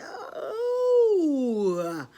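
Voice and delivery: long, high, loud oh that slides down; happy cry. Acted. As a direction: let out a drawn-out whoop of joy. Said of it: "he was so happy".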